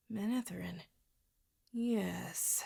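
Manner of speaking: very soft, dwelling on the thought; sinister musing. A narrator's reading. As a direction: savour a dark idea under your breath. Villain hits on a new scheme.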